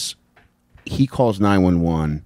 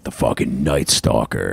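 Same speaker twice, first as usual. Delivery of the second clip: much lower, menacing nickname voice; mock ominous; 10 semitones lower than usual. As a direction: drop low for a scary title.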